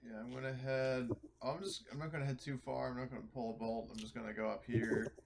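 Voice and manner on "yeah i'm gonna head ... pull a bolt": ordinary talk, nothing marked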